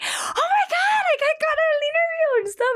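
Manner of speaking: very high, loud, fast joyful cry; tearful elated shout; overjoyed cry. Real, not acted. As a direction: shriek with happy disbelief. Her overjoyed reaction.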